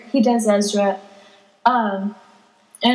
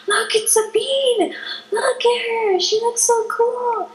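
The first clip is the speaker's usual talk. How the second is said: put-on high, breathless starstruck children; excited kids; 11 semitones higher than usual. Imitating kids spotting costumed characters from far away, thrilled. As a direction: imitate little fans squealing from afar. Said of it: "a few kids, they're just so funny".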